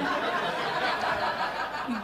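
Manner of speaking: breathy chuckle; quick airy laugh; soft laugh. Real, not acted. Laughing mid story.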